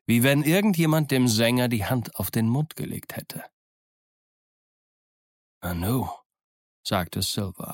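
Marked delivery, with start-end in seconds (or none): 5.6-6.1 faint, low, frightened murmur; the word dies on ashen lips; barely gets the word out. Acted. Silver forces out a word to his crew, faint with fear. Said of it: "Lippen konnten kaum das Wort hervorbringen".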